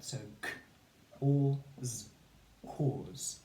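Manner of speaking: cause as k, or, z, then cause; sounding out. Shown as a demonstration. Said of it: "read each word using its sounds".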